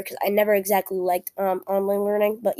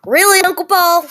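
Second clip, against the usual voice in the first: high, loud exasperated cry; exasperated, much higher and louder; exasperated outburst; 11 semitones higher, 10 dB louder than usual. Real, not acted. Blooper interrupted, exasperated outburst.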